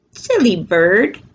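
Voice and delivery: ordinary delivery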